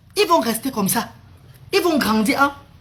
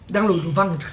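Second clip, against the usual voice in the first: ordinary talk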